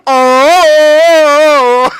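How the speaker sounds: long, loud, high "oh"; drawn-out mock cry; imitated long yell. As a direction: hold a big imitation holler, pitched high.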